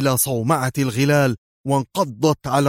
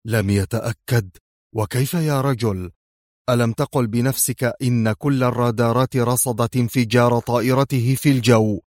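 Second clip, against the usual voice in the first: usual speaking voice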